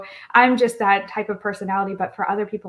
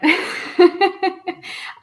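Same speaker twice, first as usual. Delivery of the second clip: light laugh; 11 semitones higher, 4 dB louder than usual. Real, not acted. Laughs at "by accident.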